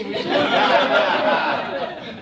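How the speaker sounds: high, laughing groan of regret; mock kicking himself, laughing.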